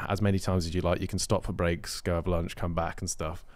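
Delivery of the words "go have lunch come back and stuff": usual speaking voice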